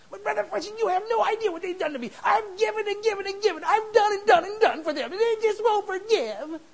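high, loud, whiny complaining voice; temper tantrum. Pretend. Congregant whining at the preacher in a high loud tantrum. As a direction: whine like a wronged child. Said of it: "Like a little temper tantrum".

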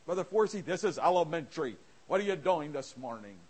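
a hint of a bored churchgoer; imagined skeptic.

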